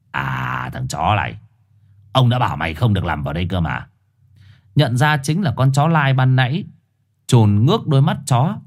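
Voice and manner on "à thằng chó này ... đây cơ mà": deep, gruff street-dog snarl; low growling dog voice. Acted. Four-eyed dog snarling at the stray Trồn, gruff and low.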